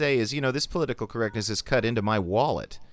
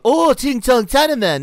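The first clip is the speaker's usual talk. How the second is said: much higher, loud mocking fake Chinese accent; 13 semitones higher, 10 dB louder than usual. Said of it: "I'm not talking about".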